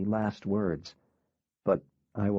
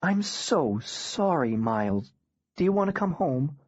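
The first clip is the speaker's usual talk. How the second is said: tender mom; 5 semitones higher than usual. Acted. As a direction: comfort a grieving son. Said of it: "Mom said".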